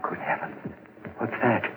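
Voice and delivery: breathless whispered gasp of alarm; hushed, frightened whisper; startled whisper. Acted. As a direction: barely voice it, spooked. Startled by a strange noise.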